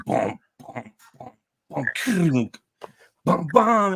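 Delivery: mock booming guitar notes, building; imitated riff. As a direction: hum the riff rising in pitch. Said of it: "you sound like a fucking alien ship landing".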